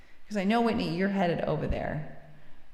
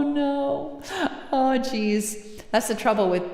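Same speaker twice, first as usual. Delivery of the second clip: usual speaking voice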